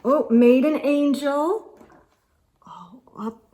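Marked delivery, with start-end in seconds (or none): 2.7-3.5 soft unvoiced whisper. Real, not acted. Parent tracing the map trail, whispering. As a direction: breathe it in a whisper.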